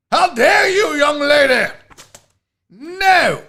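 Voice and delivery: high, loud English outrage; English accent. Acted. Host mock-scolding a viewer, shouted English accent. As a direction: shout it as a scandalized English gent. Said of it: "it's fun to do the accents".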